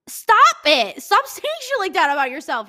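high loud mock scold; loud self-scolding. Real, not acted. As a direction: yell at yourself playfully. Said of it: "scolding herself, loud".

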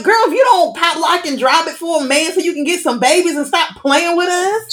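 high, sassy scolding. Retold or imitated. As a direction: scold her like a friend. Inner voice scolding Wanda.

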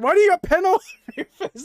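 fast, high, loud mock scolding; mock parental yell; imitating Porgy screaming. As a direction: rattle it out like an outraged dad, laugh breaking in. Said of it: "he just screamed at them".

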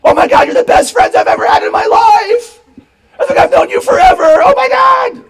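high, loud, tearful best-friends squeal; hammed-up emotional shriek; gushing emotion. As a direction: wail it out as an overwhelmed, gushing camper. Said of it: "you probably had this, um, little emotion".

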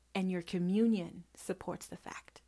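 hushed sermon whisper. Real, not acted. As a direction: whisper it like a secret truth.

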